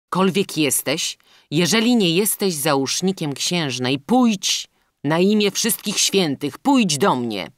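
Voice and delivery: loud, urgent summons; calling out. A narrator's reading. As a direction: implore an unseen stranger to come. Captive prince calls for help.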